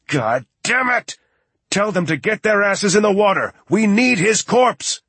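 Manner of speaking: high-pitched, furious yelling; screamed command. A narrator's reading. Lloyd screaming at the tech to get the body, furious. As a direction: scream the orders across a whole building. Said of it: "screamed loud enough to be heard".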